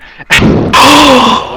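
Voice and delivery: sharp loud gasp, high. Real, not acted. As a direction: suck in air in shock. GM gasps at hit points.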